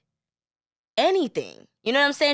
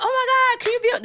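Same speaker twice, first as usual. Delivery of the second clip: squealing high fan voice; excited; much faster than usual. Retold or imitated. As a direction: gush it up high.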